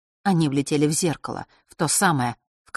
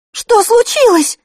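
much higher, loud alarmed cry; cried out; 11 dB louder, slower than usual. Acted. A girl crying out at the stricken boy, alarmed. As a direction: shout it in alarm. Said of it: "выкрикнула Василиса".

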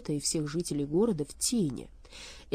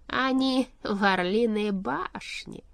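slow, drawn-out, raised announcement; weighty revelation; 4 semitones higher, 4 dB louder, much slower than usual. A narrator's reading. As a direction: spell out the news slowly. Key news about the witches.

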